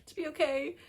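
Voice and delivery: high, loud, emotional plea; pleading to characters. Real, not acted. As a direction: beg the characters to sort it out.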